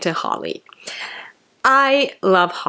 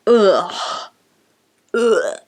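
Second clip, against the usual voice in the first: acted-out groan of revulsion; two short mock-queasy grunts, with a pause between; disgusted "ugh". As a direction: groan as if your stomach just turned. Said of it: "where I was like".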